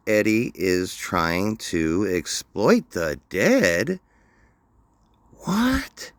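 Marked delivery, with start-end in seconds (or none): none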